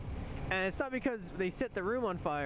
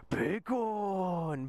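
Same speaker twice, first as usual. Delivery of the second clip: "bacon" held long in a mock Korean accent; imitated Korean accent. Korean man announces it on the walkie-talkie, imitated Korean accent. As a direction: draw it out as the Korean man did. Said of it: "He straight went".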